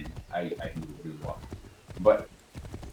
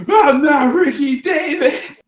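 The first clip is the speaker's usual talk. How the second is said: high, slow laughing yell of denial; laughing outcry, much higher and louder; laughing shout; 11 dB louder, slower than usual. Real, not acted. Denying being Ricky Davis, laughing.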